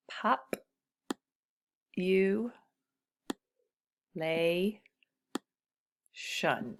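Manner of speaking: "Pop. You. Lay. Shun." said as separate beats with pauses between; syllables split apart. Shown as a demonstration. Teacher breaking population into syllables for students. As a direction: chop population into four slow beats. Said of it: "T I O N is its own syllable".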